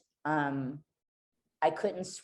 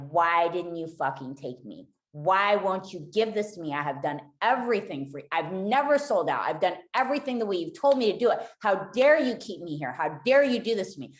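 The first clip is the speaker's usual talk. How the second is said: retold outrage building louder and higher; belligerent, hurt shouting; 4 semitones higher, 4 dB louder than usual. Woman demanding why God spared her, retold belligerent shouting. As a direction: plead and accuse, re-enacting a furious rant. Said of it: "And I just shouted, and it is so belligerent".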